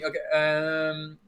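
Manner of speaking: usual speaking voice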